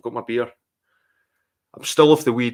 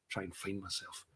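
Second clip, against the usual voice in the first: scornful self-mockery; 3 semitones lower, 17 dB quieter than usual. Vlogger mocking his own plan to find himself, muttered scornfully. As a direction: mutter it under the breath, much softer, mocking his own idea. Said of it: "What am I fucking talking about?".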